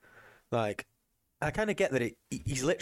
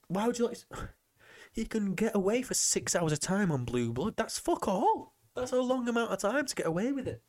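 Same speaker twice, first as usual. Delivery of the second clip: much higher, pitch climbing in disbelief; incredulous rant; 9 semitones higher than usual. Real, not acted. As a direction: push it up high, can't believe it. Incredulous rant about the plot.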